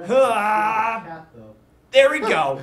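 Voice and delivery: loud mock pain yell. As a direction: shout like you got hit. Hurt in game, yelling.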